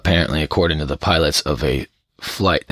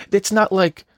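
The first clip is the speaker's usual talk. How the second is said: ordinary talk, nothing marked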